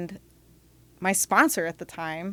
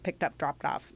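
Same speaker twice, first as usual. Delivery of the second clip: usual speaking voice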